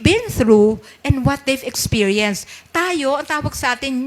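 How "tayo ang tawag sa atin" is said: usual speaking voice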